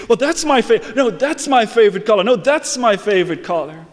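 high, amazed, tumbling with wonder; a man seeing color for the first time; breathless delight at every color; overjoyed. Retold or imitated. Father naming favorite colors.

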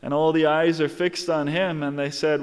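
normal talking voice

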